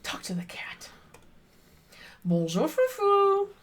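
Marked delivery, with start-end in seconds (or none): 2.2-3.5 put-on high, slow French-accented greeting; mock French greeting. The player greets the cat Frou Frou in a mock French accent.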